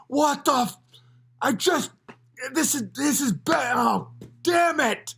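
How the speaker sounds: high, sputtering mock outrage; stammering meltdown, played for laughs; brother spiraling. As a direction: imitate frustration breaking into a drawn-out curse. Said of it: "my brother just spiraled".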